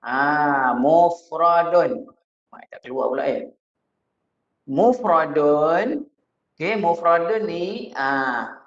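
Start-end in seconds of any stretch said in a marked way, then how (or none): 0.7-2.1 mufradun drawn out slowly, each syllable clear; slow dictation of mufradun; model pronunciation. Shown as a demonstration. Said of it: "Ustaz tulis sikit ni dia". 4.7-6.1 mufradun drawn out slowly, each syllable clear; slow dictation of mufradun; model pronunciation. Shown as a demonstration. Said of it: "Ustaz tulis sikit ni dia".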